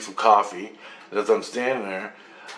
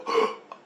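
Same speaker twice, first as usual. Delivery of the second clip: sharp mock gasp of realization; sudden intake of breath, retold; acted gasp. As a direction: gasp as if it just hit you.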